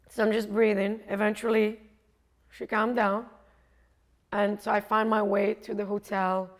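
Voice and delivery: normal talking voice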